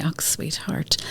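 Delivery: hushed comforting mum; soft whisper. Retold or imitated. Mum hushing her upset daughter, whispered. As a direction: whisper it tenderly to the child.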